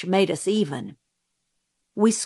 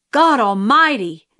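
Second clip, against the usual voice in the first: narrated yell, louder and higher; startled yell; 4 semitones higher, 6 dB louder than usual. A narrator's reading. Jim as someone leaped at us, startled yell. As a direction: yell it as a startled boy would, held in check. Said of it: "Jim yelled".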